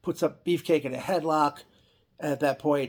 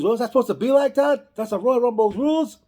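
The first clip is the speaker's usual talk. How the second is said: much higher, louder put-on wrestler voice; mock indignant Haku, pitched way up; hammed-up Haku; 10 semitones higher, 7 dB louder than usual. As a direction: bluster it like an offended big man.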